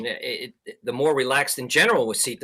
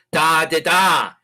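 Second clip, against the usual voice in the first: louder voiced "da" beeps imitating Morse; Morse dahs; 7 dB louder, slower than usual. Shown as a demonstration. Instructor voicing the letter K in Morse, loud dahs. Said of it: "So if you have K".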